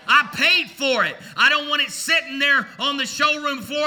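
upbeat, emphatic sermon push; lively preaching. Acted. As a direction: drive the point home. Energetic sermon illustration.

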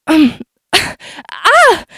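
startled laugh into a gasp; choking surprise, half laughing; nervous laugh then gasp. A narrator's reading. Button swallowed.

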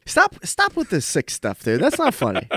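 mock outrage. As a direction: play at being offended. Mock protest at a joke.

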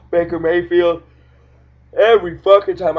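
words stretched by a yawn. Real, not acted. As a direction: talk through a big yawn. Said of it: "I start fucking yawning".

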